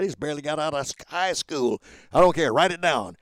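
loud, emphatic retort. Retold or imitated. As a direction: push it out loud and firm. Retorting to CEOs.

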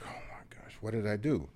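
a whispered gasp of dismay, breathy and unvoiced; shocked whisper. Nephew whispering in shock as his uncle starts crying. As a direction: whisper it as if you just hurt someone by accident.

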